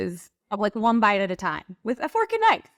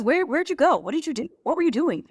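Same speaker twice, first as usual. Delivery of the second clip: higher puzzled questions; husband asking; 6 semitones higher than usual. Retold or imitated. Wife voices husband asking where she went, higher puzzled tone. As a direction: play the confused husband. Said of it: "he goes".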